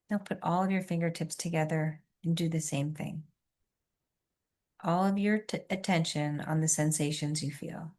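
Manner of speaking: soft, hushed relaxation guide; soothing massage murmur. Acted.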